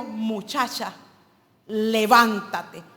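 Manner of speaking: commanding, slow levántate; Jesus commanding the girl to rise, with authority. Retold or imitated. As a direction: give the order to rise, drawn out. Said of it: "con autoridad".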